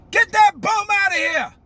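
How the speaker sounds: high, loud retold order; imitating the president. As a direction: throw out a heckler, mimicking a rally speaker. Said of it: "somebody protests and he says".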